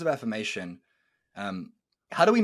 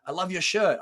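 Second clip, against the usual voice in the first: cheerful compliment; 8 semitones higher, 5 dB louder than usual. Retold or imitated. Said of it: "Like, you know".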